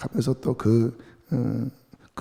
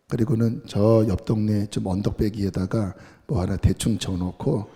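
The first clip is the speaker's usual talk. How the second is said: ordinary delivery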